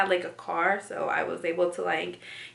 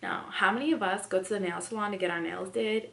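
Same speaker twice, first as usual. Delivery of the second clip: ordinary talk, nothing marked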